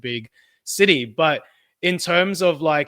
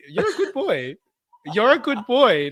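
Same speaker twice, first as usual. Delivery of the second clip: teasing laugh; 7 semitones higher than usual. Real, not acted. Teasing co-host, praising like pet.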